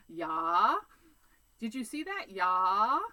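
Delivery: loud, drawn-out Minnesota "Yeah" with a rise; Fargo accent. Retold or imitated. Vlogger mimics how people in Fargo talk, loud Minnesota accent. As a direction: ham up the Fargo movie twang. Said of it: "talk like that in Fargo".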